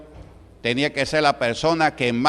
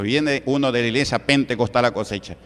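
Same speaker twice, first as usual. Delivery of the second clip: usual speaking voice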